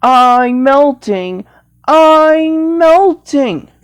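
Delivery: slow, drawn-out melting cry; Wicked Witch of the West. Pretend. Witch melting after water hits her, slow mock wail. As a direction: stretch each word in hammed-up agony. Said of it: "The Wicked Witch of the West is melting".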